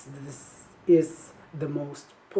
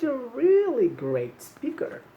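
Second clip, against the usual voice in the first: high-pitched puppet compliment; gushing praise; 8 semitones higher, 4 dB louder than usual. Pretend. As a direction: play a delighted puppet praising a pupil. Voices puppet Cookie praising the student.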